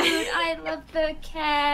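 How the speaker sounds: slow, high adoring coo; cutesy gushing. Real, not acted. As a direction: gush like it's almost too cute. Gushing over the cat.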